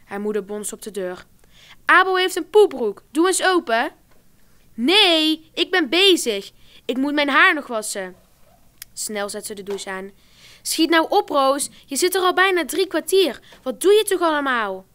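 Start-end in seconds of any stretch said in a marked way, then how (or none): 1.8-3.9 loud, high call through the door; urgent calling. A narrator's reading. Mother banging on the bathroom door, loud and urgent. 4.7-8.2 loud, higher call back; shouted refusal. A narrator's reading. Rosa refusing to open for her mother, shouting back. 10.6-14.8 loud, high exasperated urging; impatient shouting. A narrator's reading. Mother urging Rosa out of the bathroom, loud and impatient.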